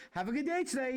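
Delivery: high, chirpy customer service sign-off; high-pitched polite farewell. Retold or imitated. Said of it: "Use my customer service voice".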